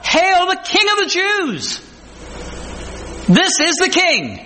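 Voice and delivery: high, drawn-out crowd acclaim; public proclamation. Acted. Crowd hailing Christ riding into Jerusalem, high slow proclamation. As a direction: proclaim it slowly to everyone, pitched high. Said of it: "publicly proclaimed to everyone".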